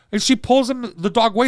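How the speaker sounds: usual speaking voice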